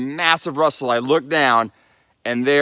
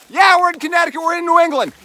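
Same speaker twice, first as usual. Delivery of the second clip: higher and louder, celebrating; excited cheering; 7 dB louder, faster than usual. Real, not acted. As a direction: cheer it out with real joy. Celebrating crossing the state line.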